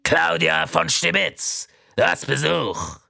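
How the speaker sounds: hollering down the hall; calling out. Acted. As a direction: announce the visitor loudly. Calling down the corridor.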